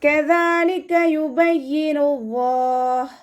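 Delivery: കദാലിക with breathy ك, slow chant; tajweed recitation. Shown as a demonstration. Teacher models the verse for students, slow chant. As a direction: recite യുബയ്യിനുല്ലാഹ് high, drawn and melodic. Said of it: "കാഫ് ഉച്ചരിക്കുന്ന സമയത്ത്".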